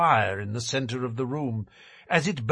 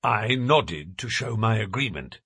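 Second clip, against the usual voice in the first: ordinary delivery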